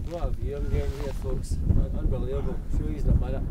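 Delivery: quietly taking in the view; hushed. Real, not acted.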